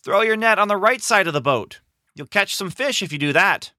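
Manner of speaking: high call carried over water; shouting. Acted. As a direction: call instructions to the boat. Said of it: "The man on the shore shouted".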